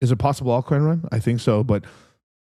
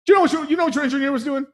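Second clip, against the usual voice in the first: mock accusing shout; 12 semitones higher, much faster than usual. Calling out engineer on Grindr.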